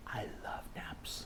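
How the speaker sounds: soft whispered confession. Acted. Storyteller whispering to children about loving naps. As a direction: breathe it like a secret.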